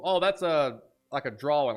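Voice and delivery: normal talking voice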